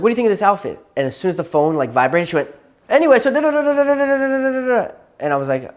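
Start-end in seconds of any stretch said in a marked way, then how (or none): 0.0-0.8 higher-pitched mock chatty question; put-on girlfriend voice, pitched up; imitating girlfriend. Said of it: "She's like this". 2.9-4.9 high, loud mock babble on one note; mimicking girlfriend talking over everything; imitating nonstop yapping. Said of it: "she just kept yapping past it".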